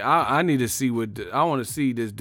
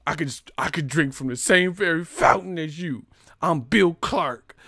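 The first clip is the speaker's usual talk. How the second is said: put-on higher, louder earnest school-kid voice; mock proud Bill Clark; 4 semitones higher, 4 dB louder than usual. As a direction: play the proud first integrated student. Acting Bill Clark as proud integrated student.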